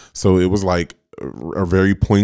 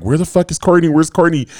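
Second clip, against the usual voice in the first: higher-pitched mock panic; retold freak-out; 8 semitones higher than usual. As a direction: act out flipping out over a missing friend. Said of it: "I'm just, like, flipping out".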